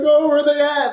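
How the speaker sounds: high-pitched, frantic mock excitement; imitated freak-out. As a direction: imitate a roommate spazzing out. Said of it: "spazzing out when the show came in".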